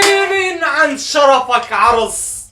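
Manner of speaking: high, slow drawn-out cheer; triumphant shout. Real, not acted. Fan closing the video with a triumphant drawn-out shout. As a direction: shout the victory cry to end.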